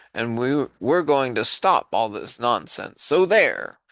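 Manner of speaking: shouting. Acted. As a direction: shout them down as rowdy monkeys. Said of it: "came shouting".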